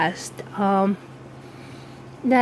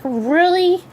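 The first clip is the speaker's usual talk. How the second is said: incredulous really; 9 semitones higher than usual. Retold or imitated. Incredulous retort to mother.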